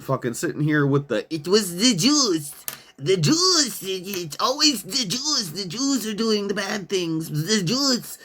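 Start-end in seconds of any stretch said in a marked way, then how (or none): 1.3-8.2 high, put-on whiny voice mocking the posters; a higher mock voice lampooning online cranks; mocking conspiracy poster. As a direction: sneer it like a brainless troll parroting a line.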